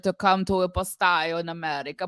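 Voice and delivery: loud, badly faked Italian accent; horrible fake Italian accent. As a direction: do a hammy Italian tenor accent. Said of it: "putting on a horrible fake Italian accent".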